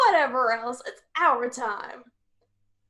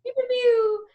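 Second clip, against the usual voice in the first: pew pew sounds; 11 semitones higher, 4 dB louder than usual. Pretend. Echoes the laser sounds.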